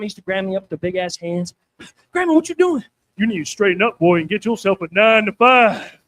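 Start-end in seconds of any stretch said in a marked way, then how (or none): 2.1-2.8 put-on higher, louder childish question; kid questioning grandma. Bad kid grabbed by his grandma, high protesting voice. 3.2-6.0 put-on loud, rough old-woman scolding; big mean grandma. Grandma ordering her bad grandkid to get a job, loud scolding. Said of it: "That was her real voice".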